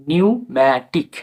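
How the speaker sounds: নিউম্যাটিক slowly as "niu-mæ-tik"; silent P demo. Shown as a demonstration. Teacher puts the word together, slow নিউম্যাটিক. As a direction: stretch নিউম্যাটিক, beginning on N. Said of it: "বলার ক্ষেত্রে silence থাকবে".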